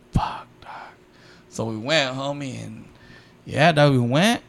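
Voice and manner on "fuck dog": breathy whisper under the breath; whispered aside. Retold or imitated. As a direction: whisper it like a secret curse. Muttered reaction to girl's proposal.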